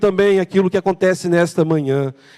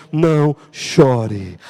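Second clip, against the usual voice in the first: slow tender voice; 6 semitones lower, much slower than usual. Acted. Jesus's words, slow and low.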